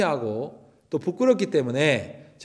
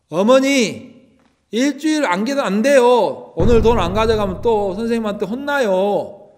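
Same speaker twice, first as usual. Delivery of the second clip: raised, urgent begging; begging mother, louder and higher; pleading child; 6 semitones higher, 4 dB louder than usual. Retold or imitated. A boy begging his mother for school fees, raised and pleading.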